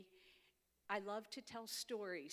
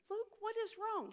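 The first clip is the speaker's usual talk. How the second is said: concerned grandma; 12 semitones higher, 5 dB louder than usual. Retold or imitated. Grandma asks laughing grandson.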